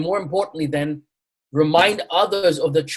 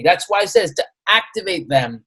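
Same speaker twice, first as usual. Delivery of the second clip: ordinary delivery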